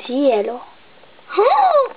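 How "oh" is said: much higher shocked Oh; scandalised cry; shocked gasp. Pretend. Shocked at the kiss.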